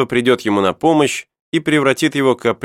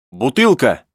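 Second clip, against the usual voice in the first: brief, lightly raised exclamation; mild cry out; 3 semitones higher than usual. A narrator's reading. Herbert gets up from the deck with his find, mild cry out. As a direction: call out the find, not at full volume. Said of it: "поднялся и крикнул".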